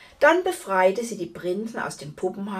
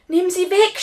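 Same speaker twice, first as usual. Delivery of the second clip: high, loud panic; frightened shout; 10 semitones higher, 8 dB louder than usual. A narrator's reading. Giantess terrified of a tiny spider, high panicked cry. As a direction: cry out in acted fear, pitch shooting up. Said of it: "schrie sie".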